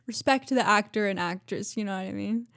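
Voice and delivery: usual speaking voice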